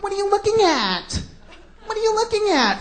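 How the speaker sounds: high-pitched mock indignant chicks; uptight women. Chicks catching you looking, mock uptight voice. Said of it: "They get so uptight".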